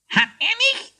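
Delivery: very high, squeaky hare voice; squawking hare. A narrator's reading. Hare objects in court for his friend, squawking. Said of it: "quäkte Quietsch".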